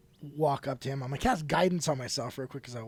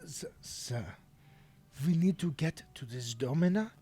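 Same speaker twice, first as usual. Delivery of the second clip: calming voice; 3 semitones lower, 8 dB quieter than usual. Acted. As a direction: gently reason with him. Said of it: "And I, again".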